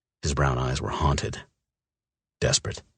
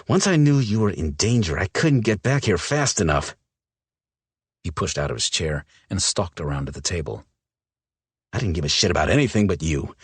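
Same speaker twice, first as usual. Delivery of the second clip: louder, pressing, raw man's voice; a scared lover pushing back; intense man voice; 5 semitones higher, 4 dB louder than usual. Acted. Mal's figure voice, intense.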